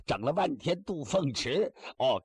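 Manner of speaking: ordinary delivery, nothing marked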